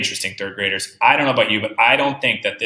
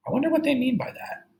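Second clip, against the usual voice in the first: much higher, soft, quick musing; teacher modelling a wonder for third graders; wondering aloud; 3 dB quieter than usual. Real, not acted.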